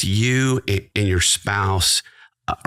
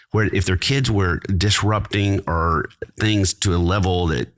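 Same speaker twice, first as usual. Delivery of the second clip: usual speaking voice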